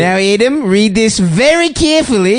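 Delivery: slow, high mock-stern instruction; a mock dad telling his son to read carefully. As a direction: spell it out to the boy slowly.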